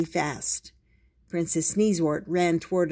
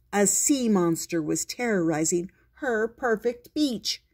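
usual speaking voice

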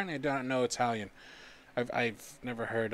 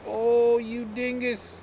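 high, loud, slow teasing scold; mock scolding; 11 semitones higher, 10 dB louder, much slower than usual. Real, not acted. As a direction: chide the game fondly. Mock scolding after a miss.

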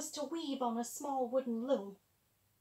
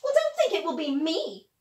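spoiled stepsister; 3 semitones higher, 9 dB louder than usual. Acted. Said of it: "said the sister who knitted".

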